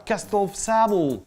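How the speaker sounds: slow "castle of SABLE", hammed-up English; mock English accent. Shown as a demonstration. Teacher turning -able words into English, mock English accent. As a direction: stretch SABLE into an English "SAY-bol". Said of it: "C'est pareil pour les mots hables".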